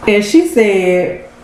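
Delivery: ordinary talk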